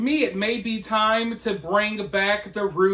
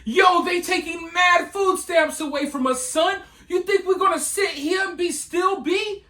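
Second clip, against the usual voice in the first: high, shouted mocking New York accent; exaggerated New York accent impression, higher and yelling; 9 semitones higher than usual. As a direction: whine it like the guy, in a thick New York accent. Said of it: "in his New York accent".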